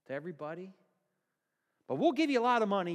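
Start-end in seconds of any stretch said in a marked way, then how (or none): 1.9-2.9 fast, louder slick bribe offer; quick bribe. Acted. Elders bribing the soldiers, fast slick pitch. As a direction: push the money deal fast. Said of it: "them crack soldiers saying that".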